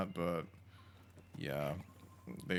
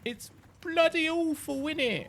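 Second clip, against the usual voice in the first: high, slow British "bloody awful", clipped BLUD-ee; posh British accent; 9 dB louder than usual. Shown as a demonstration. Mocking the fake British voice, a hammy British accent. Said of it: "Said in the most British way possible".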